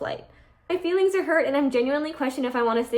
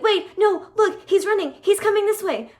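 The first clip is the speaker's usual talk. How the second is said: higher, louder pretend panic at the gate; frantic pleading; 8 semitones higher, 5 dB louder than usual. Begging the gate agent to wait for her boyfriend, frantic and higher. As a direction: beg the agent urgently, pointing out someone running.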